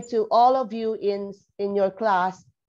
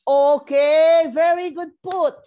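higher and louder burst of praise; delighted teacher's praise; pleased praise; 6 semitones higher, 7 dB louder than usual. Real, not acted. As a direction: reward the right answer with a lift. Praising student's correct answer.

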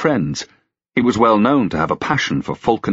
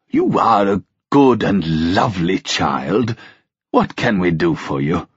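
put-on slow, tender old king's voice; slower than usual. A narrator's reading. Old king thanking Melanthe, slow and fond. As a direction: speak gently as a grateful old king.

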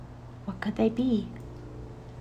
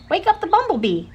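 squirrel voice; 12 semitones higher, 6 dB louder, faster than usual. Acted.

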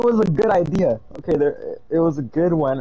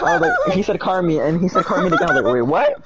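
laughing retelling. Real, not acted. Friend recounting the car meet joke, laughing. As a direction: tell it while still laughing.

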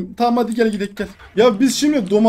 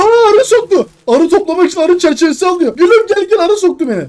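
high, loud startled yelp; yelping in pain; 7 semitones higher, 5 dB louder than usual. Real, not acted. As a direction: cry out as if stung. Stung by a bee in game.

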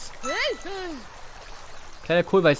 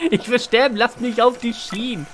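high hammed-up whining, slowing at the end; mock despair; 7 semitones higher than usual. Mock despair during the game. (gespielt jammernd, langsamer)

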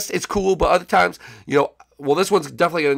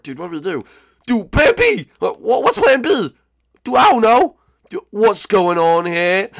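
ordinary talk

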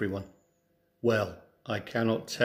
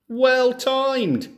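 much higher, loud hearty call; 13 semitones higher, 8 dB louder than usual. Acted. Farmer greets the approaching thieves, loud cheerful call. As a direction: hail them from across the field. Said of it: "he called".

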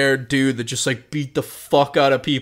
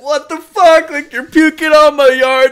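high, loud mock yell of outrage; 13 semitones higher, 7 dB louder than usual. Homeowner yelling at the puking teen, high loud mock yell. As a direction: yell it high like an angry host. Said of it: "yelling at me".

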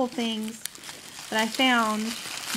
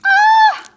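very high, loud squeal of joy; excited scream; 15 dB louder than usual. Real, not acted. Seeing the romance novel stuff, excited scream. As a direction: shriek with delighted surprise.

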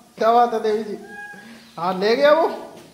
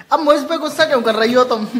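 louder and fast, teasing the monkey; playful loud scold; 4 semitones higher, 9 dB louder, faster than usual. Real, not acted. Vlogger teasing his pet monkey Bajju, playful loud scold. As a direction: scold Bajju, half joking, at pace.

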